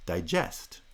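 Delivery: diGEST, pitch placed on GEST; stress on second syllable. Shown as a demonstration. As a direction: verb digest with primary stress at the end. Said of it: "there's also a related verb".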